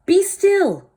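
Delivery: high, loud command; commanding shout. Acted. Max commands the wild things, high and loud. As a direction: order the monsters to freeze. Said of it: "Till Max said".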